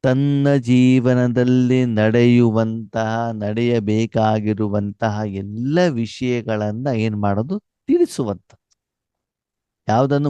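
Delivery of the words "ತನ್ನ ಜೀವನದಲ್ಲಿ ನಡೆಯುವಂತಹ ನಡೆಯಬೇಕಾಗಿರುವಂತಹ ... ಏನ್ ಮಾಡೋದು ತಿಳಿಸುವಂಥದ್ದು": very slow, deliberate explaining; slow teaching voice; slow definition. Acted. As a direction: spell it out word by word. Teacher defines a term slowly.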